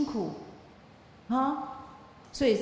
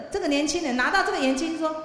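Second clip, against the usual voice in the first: ordinary talk, nothing marked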